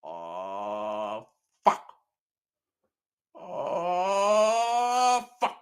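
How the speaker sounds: long, drawn-out clucking "oh", then a short squawk; soft crooning chicken cluck breaking off short; paranoid chicken. Acted. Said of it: "chicken looks up and goes".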